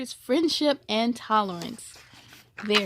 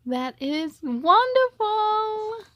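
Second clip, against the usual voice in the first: delighted; 11 semitones higher, 4 dB louder, much slower than usual. Real, not acted. Reader delighted aside.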